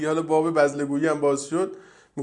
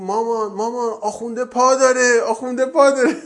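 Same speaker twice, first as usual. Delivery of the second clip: mock child's excited shout, higher and louder; hammed-up child's yell at the fruit shop; 8 semitones higher, 6 dB louder than usual. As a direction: call out to mum like an amazed kid, laughing through it. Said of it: "بچه یهو داد زد".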